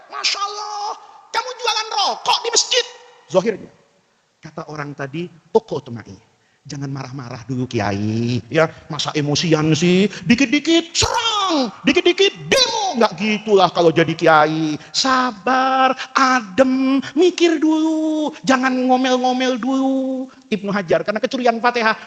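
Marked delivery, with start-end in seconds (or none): 0.1-2.8 high, astonished outcry; shocked exclamation. Retold or imitated. Shocked sheikh in retold story. 5.3-20.3 calm seller. Retold or imitated. Seller calms angry sheikh.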